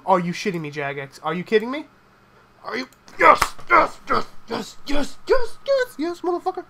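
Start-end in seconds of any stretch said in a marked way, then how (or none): 2.6-6.6 shrieked, much higher and louder yeses; screaming yes. Real, not acted. Streamer yelling at the game after a comeback win, triumphant screaming. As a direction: erupt in triumph, each yes climbing. Winning the duel at last.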